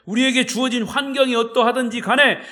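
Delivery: loud, high, fervent exhortation; preaching at full force. Acted. Pastor urges the congregation toward thankful hearts, preaching loud and fervent. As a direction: lift the congregation with the closing call.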